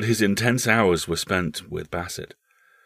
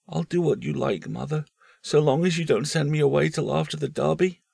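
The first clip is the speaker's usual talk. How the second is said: put-on higher, pleading boyish voice; boy Paul; 8 semitones higher than usual. Paul bargaining with his mother, higher and pleading.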